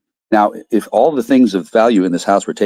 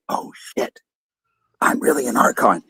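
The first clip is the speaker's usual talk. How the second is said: hushed whisper of a startled fake wizard; whispered Carl; 6 dB quieter than usual. Mimicking fake dead Carl, whispering. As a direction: breathe it out in a sudden whispered realisation. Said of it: "fake dead Carl is like".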